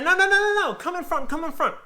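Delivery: put-on squeaky old lady insisting; high old lady. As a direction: wave him to the front seat. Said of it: "She's like".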